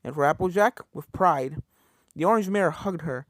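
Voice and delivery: usual speaking voice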